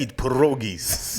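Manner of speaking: slow fake Russian with rolled-out food word; comic Eastern European accent; mock Russian accent. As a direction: declare it like a stage Russian. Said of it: "read the comments in Russian".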